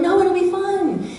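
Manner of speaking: high, slow sing-song; bright put-on cheer; mock cheery voice. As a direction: mimic an upbeat fun-lover. Mimicking a yellow's cheery reply.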